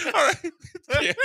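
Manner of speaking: high, laughing through the words. Real, not acted. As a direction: talk while still cracking up. Laughing while talking.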